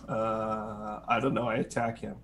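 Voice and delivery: soft, low, uncommitted mumble; laid-back player. Acted. Laid-back player half-heartedly choosing an attack, lazy and soft. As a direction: act too relaxed to care. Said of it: "Just a team of laid back guys".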